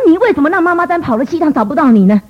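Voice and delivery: re-enacting a furious mother; retold angry scolding. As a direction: scold the child in a high, heated rush of reproach. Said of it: "我非常的生气".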